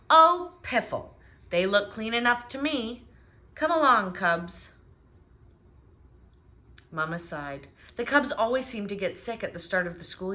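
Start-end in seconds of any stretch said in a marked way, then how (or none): 0.1-4.7 loud, scoffing piffle; dismissive Papa. Acted. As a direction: wave off Mama's advice. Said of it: "said Papa".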